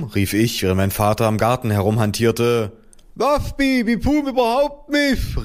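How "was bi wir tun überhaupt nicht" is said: slow, very high mushy lisp; mother calling out. A narrator's reading. Mother vetoing help for hornets, muffled high cry. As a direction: protest high through a swollen mouth. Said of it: "rief meine Mutter".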